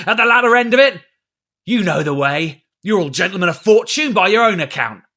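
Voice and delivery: captain bellowing at mutineers; roaring threats. A narrator's reading. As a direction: dare them to draw steel. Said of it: "roared Silver".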